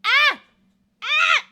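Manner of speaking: sharp, very high, loud cry of pain; real pained yelp. Real, not acted. Burning himself on hot noodles, real high yelps. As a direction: yelp from a burnt throat. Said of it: "I didn't wait for it to cool down".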